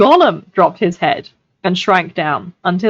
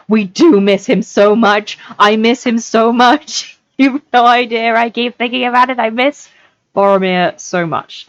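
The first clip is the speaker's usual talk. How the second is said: stretched-out, higher fan's lament; fond lament; 6 semitones higher, 3 dB louder than usual. Real, not acted. Reader missing Boromir while telling listeners, fond lament. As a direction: pine for a favourite character, half laughing.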